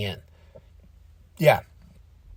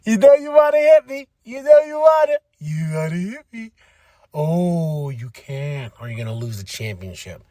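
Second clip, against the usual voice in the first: taunting Drew; 3 semitones higher than usual. Retold or imitated. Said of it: "He's like".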